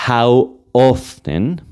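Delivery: how often drawn out very slowly; teacher stretching how often for learners; slow teaching model. Shown as a demonstration. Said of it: "si aquí yo digo".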